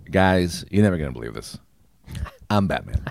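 low, soft mock-solemn reveal; hushed confession. Billionaire confides in the public, low and hushed. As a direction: drop low and quiet for a big secret.

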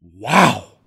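loud, stunned wow; a loud stunned wow at the push-ups. Retold or imitated. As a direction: let the shock burst out. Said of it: "I said, "Wow," out loud".

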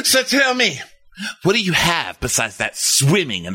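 high, hammed-up sneering opener; mock condescending husband. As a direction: sneer it down at her. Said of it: "And Jake goes".

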